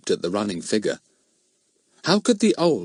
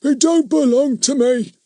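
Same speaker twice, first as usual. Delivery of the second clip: put-on very high, louder stubborn old voice; obstinate old man; 5 dB louder than usual. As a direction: dig in like a stubborn old tramp. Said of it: "said Fatty obstinately".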